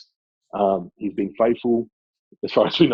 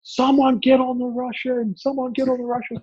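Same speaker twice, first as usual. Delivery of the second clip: retold sideline yell, pitched way up; imitated coach's yell. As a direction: mimic an opposing coach yelling orders across the field. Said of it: "opposing coach yell out".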